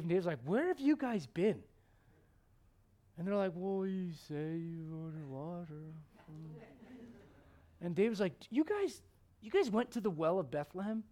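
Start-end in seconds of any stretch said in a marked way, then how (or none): 0.4-1.6 high, loud, baffled question; acting out an astonished leader; incredulous demand. Acted David greeting returning men. 3.5-5.9 slow, strained, worn-out reply; hammed-up weary runner; mock exhaustion. Acted exhausted men back with water. 8.5-11.0 high, loud, amazed question; astonished disbelief. Acted. Acted David astonished at the men.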